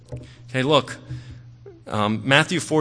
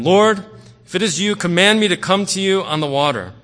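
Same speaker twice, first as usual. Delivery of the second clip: raised, higher-pitched plea read aloud; Peter's earnest call, louder and higher; calling out to the Lord; 6 semitones higher, 6 dB louder than usual. Acted. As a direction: call it out to someone across the water.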